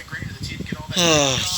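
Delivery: long, falling sigh; resigned sigh. A narrator's reading. Boy giving in to forgive her brother, long sigh. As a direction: breathe out a drawn-out sigh. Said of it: "he would just sigh".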